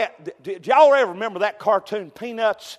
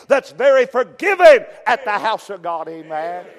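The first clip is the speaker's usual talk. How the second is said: fervent, loud country preaching; 5 semitones higher, 7 dB louder than usual. Acted. Preacher exhorting his church, full fervent preaching. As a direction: preach it out with fire to the congregation.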